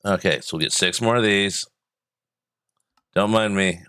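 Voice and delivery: talking while chewing gum. Real, not acted. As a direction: chew gum while you talk. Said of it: "I'm trying to talk and chew gum".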